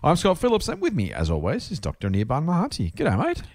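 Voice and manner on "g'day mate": high, playful mock Australian greeting; laconic outback drawl. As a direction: toss off a lazy outback G'day. Host's mock Aussie greeting.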